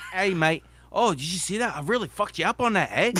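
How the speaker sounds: mock New Zealand lad bragging; Kiwi accent. Player gloating to a tackled opponent, in a cheeky Kiwi accent. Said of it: "His Kiwi voice".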